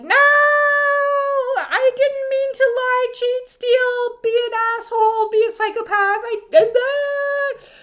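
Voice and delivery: very high, loud, whining excuses; high whiny pleading. Pretend. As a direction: beg like a caught cheat. Deceitful ones pleading.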